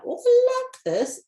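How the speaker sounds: high bright burst of delight. Real, not acted. Reader thrilled by the card she drew, excited gush. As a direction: gush over the card you love.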